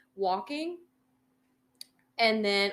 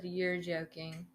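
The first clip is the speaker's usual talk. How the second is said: inner thought; 3 semitones lower, 10 dB quieter, slower than usual. Retold or imitated. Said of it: "I didn't say that, but I was thinking in my head".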